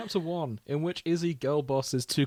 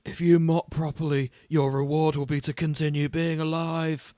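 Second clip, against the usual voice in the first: a hint of a gruff first mate, louder; Izzy's voice; 4 semitones higher, 6 dB louder than usual. Podcast host reads Izzy's line in a light gruff voice. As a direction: read it as Izzy would say it, with menace. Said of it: "'Cause that's how Izzy speaks".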